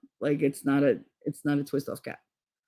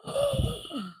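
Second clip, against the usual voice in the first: sharp high gasp; 7 dB quieter than usual. Retold or imitated. Boob hit by running brother.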